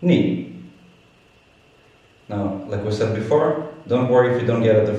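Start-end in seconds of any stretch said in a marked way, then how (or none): 0.0-0.6 "Ni" abruptly falling from high; fourth tone. Shown as a demonstration. Teacher drilling fourth tone, "Ni" falling abruptly. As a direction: drop "Ni" sharply from up to down. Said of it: "abruptly from up to down".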